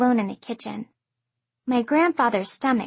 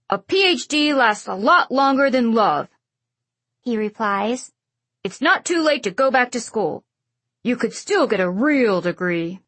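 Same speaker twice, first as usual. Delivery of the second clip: cranky grandfather voice, louder; grumpy old man; 3 dB louder than usual. A narrator's reading. Grandfather lectures mom.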